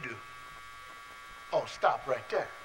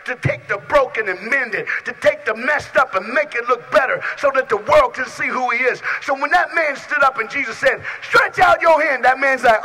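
loud preaching, climbing higher and louder; preaching at full force; 7 semitones higher, 9 dB louder than usual. Acted. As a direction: build the sermon to a roar. Preaching builds to climax.

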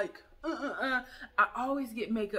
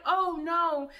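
higher, louder, sweetly dismissive; imitating friends brushing off her worry; mock polite denial; 7 semitones higher, 5 dB louder than usual. Friends reassuring her about wigs, mock polite denial. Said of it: "they're like".

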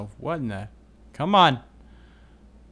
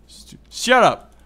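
annoyed snap; 10 semitones higher, 8 dB louder, slower than usual. Real, not acted. Zombie in the way.

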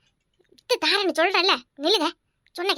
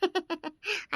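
teasing laugh; 10 dB quieter than usual. Acted. Teasing laugh after a prank.

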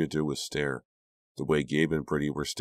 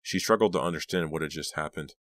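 plain reading voice

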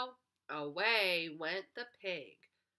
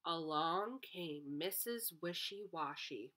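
ordinary delivery

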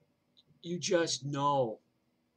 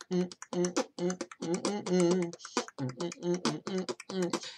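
hummed disco beat, higher; 5 semitones higher than usual. Retold or imitated. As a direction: hum the disco rhythm. Imitating disco music beat.

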